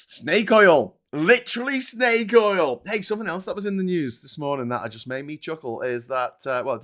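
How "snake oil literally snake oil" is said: scornful. Real, not acted. Mocking RFK cod liver oil.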